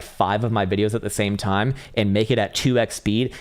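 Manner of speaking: usual speaking voice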